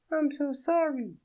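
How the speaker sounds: a startled French woman, voiced high; French accent. Naked woman startled by Fredo, in a high French accent.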